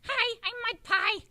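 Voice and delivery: high, loud, slow eager puppet; enthusiastic Mud Pie. Acted. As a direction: introduce yourself as a bubbly puppet. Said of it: "Mud Pie is very enthusiastic".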